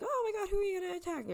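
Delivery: fast, high-pitched panicky voice; hammed-up jittery squeal; mock frightened. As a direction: squeak out the question in pretend fright. Scared country voiced asking back.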